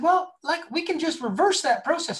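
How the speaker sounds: high, breezy lady voice; imitating the editor. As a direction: pitch it up and toss it off lightly. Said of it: "They said".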